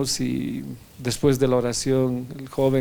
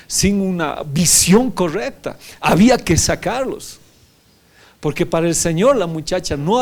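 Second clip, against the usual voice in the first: high, loud fiery sermon; preaching; 6 semitones higher, 5 dB louder than usual. Acted. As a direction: preach it out with force. Preaching at full force.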